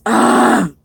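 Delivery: real frustrated "ugh"; wordless groan of frustration; exasperated groan. Real, not acted. Exasperated by pickup problem.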